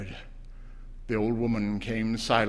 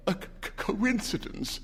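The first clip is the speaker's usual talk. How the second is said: high, hesitant stammer; 9 semitones higher than usual. A narrator's reading. Husband stammering his excuse to his wife. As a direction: stumble on the first sound. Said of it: "stammered the old man".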